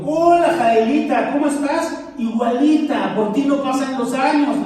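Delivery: high, loud, slow mock gush of delight; gushing greeting. Friend Vero gushes over his wife at the seminary, high and loud. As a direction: imitate an old friend squealing hello.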